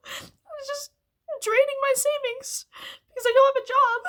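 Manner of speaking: sobbing out the frustration, voice cracking; crying. Real, not acted. Breaking down over school money.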